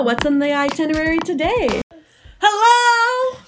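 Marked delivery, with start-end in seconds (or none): 2.4-3.4 high, bright call of hello; excited greeting. Acted. Host greeting her listeners, high excited hello.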